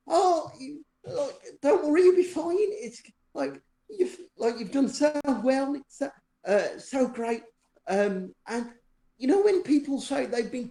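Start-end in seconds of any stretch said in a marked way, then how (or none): 0.1-7.4 reassuring surgeons. Retold or imitated. Surgeons soothing the waking patient, mock-cooing. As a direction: coo reassurance over a patient. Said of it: "surgeons around me go".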